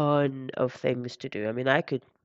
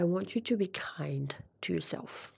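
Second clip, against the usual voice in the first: whispering; 7 dB quieter than usual. Real, not acted. Tender advice.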